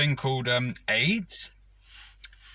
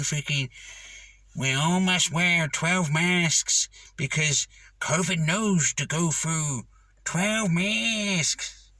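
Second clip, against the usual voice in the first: slow, higher mocking official voice; slow, pompous send-up of a health official, pitched up; mocking Anthony Fauci impression; 4 semitones higher, much slower than usual. Mocking Fauci's mask rules, in a slow, higher send-up voice. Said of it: "I'll do a good impression of him".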